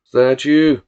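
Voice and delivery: put-on loud greeting in Northside accent; harsh Northside accent. A narrator's reading. Tulloch greeting Billy in the yard, loud Northside accent. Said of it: "he cheered in a harsh Northside accent".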